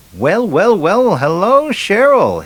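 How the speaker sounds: high, loud, drawn-out hello; a hammed-up ladykiller greeting; showy greeting. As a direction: killer charming the girl, high and loud. Killer greets girl showily.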